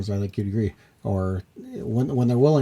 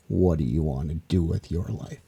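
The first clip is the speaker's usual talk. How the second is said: stern father impression; 6 semitones lower than usual. Host quoting an eighties music video dad, mock stern. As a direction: play the domineering dad, pitched low and deliberate.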